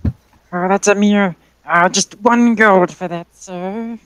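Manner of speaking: put-on old lady shopkeeper. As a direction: play an elderly woman minding her shop. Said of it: "burp in the old lady voice".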